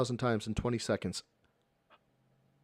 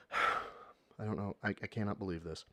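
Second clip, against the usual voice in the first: exhausted sigh; 8 semitones lower, 12 dB quieter than usual. Real, not acted. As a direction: let the words sink out on a sigh. Worn out by the streamer's plan.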